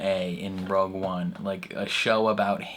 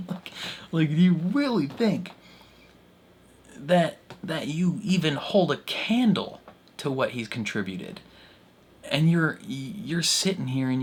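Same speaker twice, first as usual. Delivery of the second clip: indignant; 6 semitones higher than usual. Retold or imitated. Ranting at the council, hammed-up indignant voice. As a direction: scoff at them in a raised voice.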